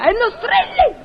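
high-pitched telephone rebuke; indignant scolding. Acted. Portiera to a shouting caller, indignant and high. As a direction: jump up sharply to tell off the caller.

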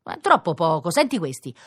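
usual speaking voice

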